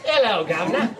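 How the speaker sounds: laughing, high jokey cockney greeting; tossing off a hammed-up British hello; mock British accent. Host mocks accents with a laughing fake British greeting. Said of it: "Not what y'all sound like".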